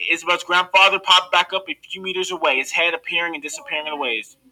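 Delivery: ordinary delivery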